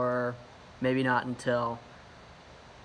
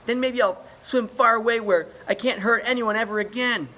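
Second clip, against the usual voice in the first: crying; 9 semitones higher, 7 dB louder than usual. Acted. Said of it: "Ichabod started crying".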